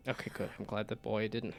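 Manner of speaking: normal talking voice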